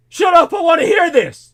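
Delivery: high, loud, impatient yell imitating him; mock shout. Friend snapping at him to hear the British radio voice, high mock shout. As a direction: bark it out like someone desperate to hear the radio. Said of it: "and he says".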